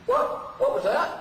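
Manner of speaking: high, incredulous repeat of the question; mock co-star acting baffled, voice jumping up; imitating Tim Russ. Co-star feigning confusion on set: high mock disbelief. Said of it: "this is my imitation of Tim Russ".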